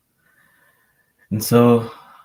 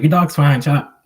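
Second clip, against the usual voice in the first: dismissive mom; 6 semitones higher, 8 dB louder than usual. Retold or imitated. Mother dismissing his worry.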